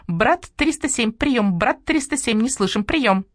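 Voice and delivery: high, flat radio-operator drone; a starling parroting a radio call in a high monotone. Acted. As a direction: repeat it on one high note like a relay. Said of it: "На одной ноте повторил Скворец".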